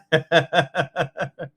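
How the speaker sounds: long chuckling laugh; real, rippling laughter. Real, not acted. Teacher amused by the class's Scottish accents, laughing.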